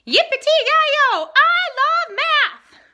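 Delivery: slow, very high, very loud whoop; too loud. A narrator's reading. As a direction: bellow it with pure joy. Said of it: "His words came out too loud".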